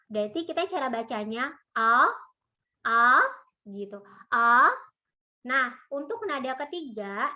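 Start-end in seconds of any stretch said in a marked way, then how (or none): 1.8-2.1 A gliding upward; second tone, rising. Shown as a demonstration. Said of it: "nadanya naik". 2.8-3.2 A gliding upward; second tone, rising. Shown as a demonstration. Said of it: "nadanya naik". 4.3-4.7 A gliding upward; second tone, rising. Shown as a demonstration. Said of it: "nadanya naik".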